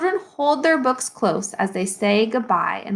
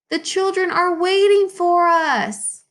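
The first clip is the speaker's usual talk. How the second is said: higher, coaxing plea to the burro; lightly voiced Luis coaxing; urging burro on; 8 semitones higher, 3 dB louder than usual. Luis coaxing his stubborn burro, higher and pleading.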